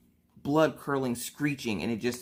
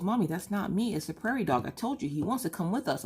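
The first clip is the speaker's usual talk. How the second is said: put-on child voice; 9 semitones higher than usual. Daughter voiced in story.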